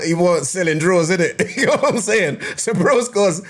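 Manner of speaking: talking through laughter; words broken by giggles; laughing. Real, not acted. Laughing at the lyric.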